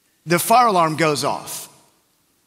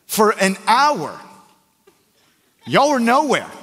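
indignant; 5 semitones higher than usual. Retold or imitated. Friend's indignant retelling.

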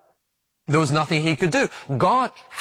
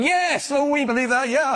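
crowd cheering; 11 semitones higher than usual. Retold or imitated. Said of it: "they shouted".

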